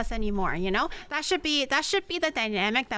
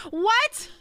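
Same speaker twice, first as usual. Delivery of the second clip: high, loud startled what; jolted by the message; surprised what; 8 semitones higher, 5 dB louder than usual. Real, not acted. Streamer startled by a viewer's comment. Reads shocking chat message.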